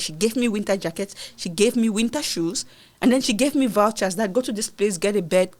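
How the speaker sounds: normal talking voice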